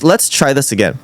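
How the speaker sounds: ordinary talk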